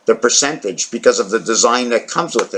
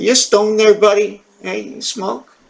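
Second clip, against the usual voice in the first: much higher, teasing voice for the cat; pet talk; 7 semitones higher than usual. Acted. Teasing his cat about being stoned, high pet voice. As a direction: coo up high at the cat like it's a buddy.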